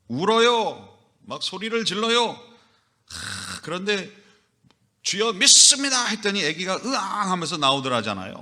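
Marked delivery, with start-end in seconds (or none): 5.0-6.1 much higher, loud cry to the Lord; desperate cry. Retold or imitated. Mother in labor crying out to the Lord, high and loud.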